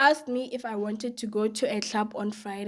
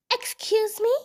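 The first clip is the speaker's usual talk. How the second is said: put-on much higher pointed "Excuse me?" from an old lady; shrill old lady; 11 semitones higher than usual. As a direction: voice an offended old lady, high up. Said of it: "the same lady goes".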